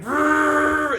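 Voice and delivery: loud high revving growl rrrr; engine revving. Retold or imitated. Mimicking a car revving in neutral, loud high rrrr. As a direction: rev it up like an engine at red line.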